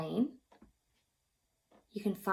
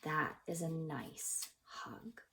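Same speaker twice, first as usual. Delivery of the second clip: soft whisper; 3 semitones lower, 9 dB quieter, slower than usual. Acted. Whispered aside on a warm hug.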